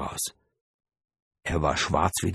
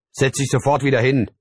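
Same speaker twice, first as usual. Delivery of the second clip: loud, raised order from father; stern command; 7 semitones higher, 5 dB louder than usual. A narrator's reading. Father orders the child back to the seat, stern command. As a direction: order it, no argument. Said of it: "befahl er".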